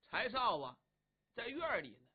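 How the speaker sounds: ordinary delivery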